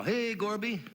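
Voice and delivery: high-pitched mock hail; pianist's casual greeting. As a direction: call out playfully to a buddy, pitched high. Pianist imagined greeting the Russian leader.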